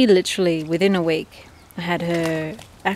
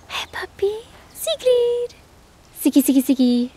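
high calling voice; 13 semitones higher, 3 dB louder than usual. Real, not acted. Calling ducks.

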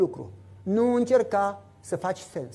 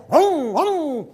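engine hum; 4 semitones higher than usual. Retold or imitated. Motorcycle heard coming home.

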